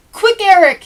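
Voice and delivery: loud high call to a friend; shout. Acted. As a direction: shout it out, eager to play. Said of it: "he'd shout".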